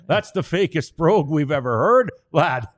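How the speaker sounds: mock Irish accent. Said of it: "'cause they'd go".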